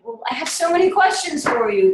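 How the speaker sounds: eager excited reply. Retold or imitated. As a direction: gush it out, keen to ask everything.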